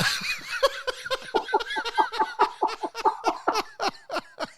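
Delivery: long, high wheezing laugh; helpless laughing fit after a punchline; real laughter. Real, not acted.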